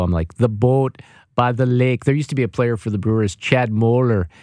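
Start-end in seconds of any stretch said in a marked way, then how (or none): 0.3-2.0 "boat" with a long stretched Wisconsin O; mock Wisconsin vowels, slow; drawn-out O. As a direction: drag out the O in "boat" slowly. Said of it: "she draws out the O".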